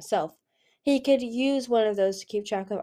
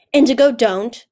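loud, higher cry of alarm; urgent shout; 4 semitones higher, 13 dB louder than usual. A narrator's reading. Fathom yelling at Indigo to stop the blade, loud alarmed shout. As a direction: shout to stop her before she strikes.

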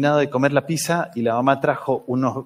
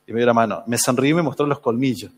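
ordinary delivery

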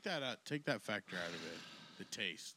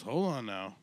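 mock doctor call; 11 dB louder, slower than usual. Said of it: "the doctor goes".

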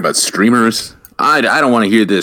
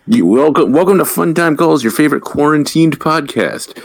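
announcer intro; 7 semitones higher than usual. Acted. Host announces show intro.

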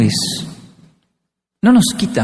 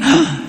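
high, startled "ah"; shocked gasp; 8 semitones higher than usual. Retold or imitated. Father spots the finger mark in the bathroom, shocked gasp. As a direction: gasp as if caught by surprise.

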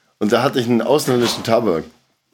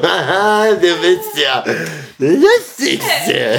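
higher, drawn-out sarcastic mock laugh; ironic voice; 5 semitones higher, much slower than usual. Imitating the guest's ironic voice, sarcastic and slow. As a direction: drip sarcasm, stretching the last word. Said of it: "seine ironische Stimme so".

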